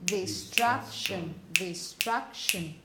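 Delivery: "Distraction" slow, with stress on "trac"; slow stressed model. Shown as a demonstration. Teacher modelling the word for the class, slow, with stress on "trac". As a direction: draw out "Distraction", leaning on the middle syllable. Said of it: "Where do you have the stress?".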